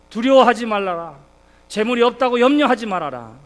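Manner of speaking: loud, emphatic divine command; God's command. Acted. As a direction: proclaim it as God's firm word to Abraham. Voicing God commanding Abraham.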